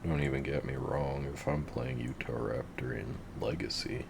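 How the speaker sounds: ordinary talk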